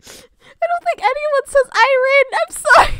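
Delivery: very high, loud squeal; laughing apology. Real, not acted. Streamer apologising to teasing chat, squealing with laughter. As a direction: shriek it while cracking up.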